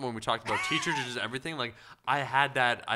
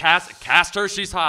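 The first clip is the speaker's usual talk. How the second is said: loud, higher, pushy producer voice; bossy producer; 5 semitones higher, 11 dB louder, much slower than usual. Retold or imitated. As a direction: boss demanding a casting choice. Imitating Adam Sandler casting.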